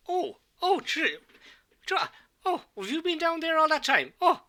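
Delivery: put-on high-pitched, fussing old priest; concerned older priest. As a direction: fret over someone in a high, fluttering voice. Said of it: "he seems quite concerned".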